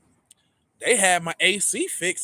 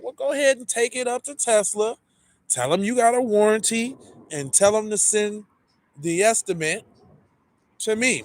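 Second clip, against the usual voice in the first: lightly voiced dealer, higher; a hint of a chirpy salesman; 6 semitones higher, 4 dB louder than usual. Dealer on the phone assures the caller, higher voice.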